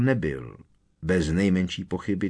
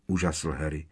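plain reading voice

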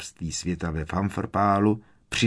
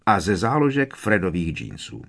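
ordinary delivery

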